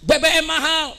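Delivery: high, mild imitated protest call; fathers' mock cry. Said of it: "Bapak-bapak kita teriak". (tiruan seruan bapak-bapak)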